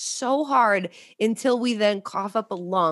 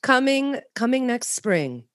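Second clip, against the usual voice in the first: slow, drawn-out announcer read with pauses; mock promo announcer; 4 semitones higher than usual. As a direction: present a spoof coming-attraction with grand pauses.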